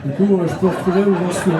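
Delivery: ordinary talk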